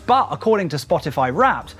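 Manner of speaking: usual speaking voice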